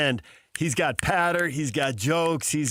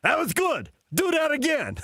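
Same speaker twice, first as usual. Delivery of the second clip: much higher, playful tour-guide voice; imitating the tour driver; 13 semitones higher than usual. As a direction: mimic the bus driver egging him on, pitched way up. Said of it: "he picks up and goes".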